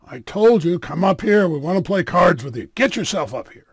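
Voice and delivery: loud, forceful command; bullying order. Acted. Burly man orders the rabbi over, loud and bullying. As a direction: demand he come up now.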